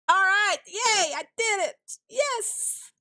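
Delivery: excited cheer. Real, not acted. Finally wins the permit.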